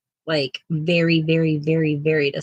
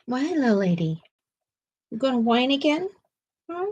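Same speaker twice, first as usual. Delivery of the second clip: higher, teasing sing-song to the dog; pet talk; 5 semitones higher, 3 dB quieter than usual. Real, not acted. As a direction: coo at the pet playfully. Talking to her pet.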